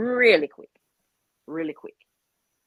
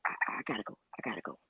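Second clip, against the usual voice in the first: whispered, much softer and lower; breathy hush; 8 semitones lower, 13 dB quieter, much faster than usual. Retold or imitated. Friend whispers goodbye before unmuting, hushed and secret. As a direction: hush it like sneaking off the call.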